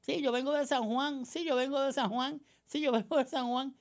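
high mock voice repeating the same answer; mocking repeated sound bite, high and sing-song; mocking interviewee. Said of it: "fueron entrevistados algunos por los medios".

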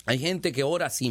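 ordinary talk, nothing marked

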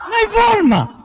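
high emphatic shout; loud high declaration. Shown as a demonstration. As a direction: shout it out for the audience. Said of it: "Dígalo fuerte".

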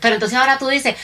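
ordinary talk

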